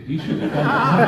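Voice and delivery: laughing as the punchline lands; laughing through it. Retold or imitated. Director quotes Rick's joke while laughing. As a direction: let the laugh break into the words.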